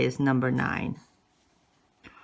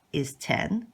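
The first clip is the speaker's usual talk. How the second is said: normal talking voice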